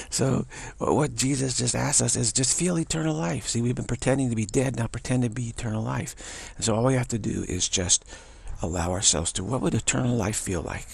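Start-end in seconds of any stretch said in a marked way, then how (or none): none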